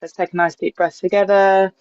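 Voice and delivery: ordinary talk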